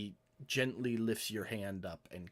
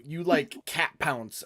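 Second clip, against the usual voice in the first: ordinary talk, nothing marked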